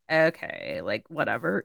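low, soft mock brush-off; dismissive. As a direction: imitate a snooty worker shrugging it off. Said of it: "gave me a dirty look and been like".

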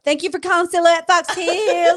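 put-on high, bright, loud phone greeting with her accent; excited store greeting. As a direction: sell it like the boss demands. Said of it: "but with her accent".

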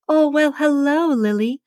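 put-on high, sing-song old-lady coo. A narrator's reading. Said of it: "she coos".

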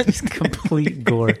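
laughing. Real, not acted. Laughing joke.

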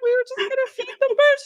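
put-on high, fast, whiny little-kid protest; innocent kid excuse. Kids explaining to mom why they fed birds, high and whiny. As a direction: play the guilty child pleading innocence. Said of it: "We were like".

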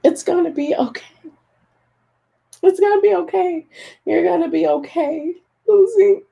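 comforting herself through real tears; crying. Real, not acted. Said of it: "more so I'm crying".

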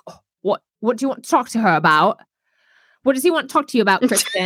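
put-on British accent, a bit huffy. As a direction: imitate a huffy Brit, words rushed. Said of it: "That's my British accent, you guys".

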